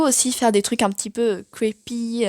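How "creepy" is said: English word with a French accent; poor English accent. Real, not acted. Vlogger apologising for her English, French accent. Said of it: "Excusez mon accent anglais qui est pitoyable".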